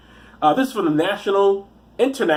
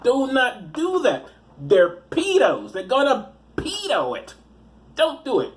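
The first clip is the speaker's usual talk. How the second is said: slow, high, insistent; exasperated, pleading rant; emphatic warning; 8 semitones higher, slower than usual. Real, not acted. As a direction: drill each word in, stretched out. Rants a warning to parents.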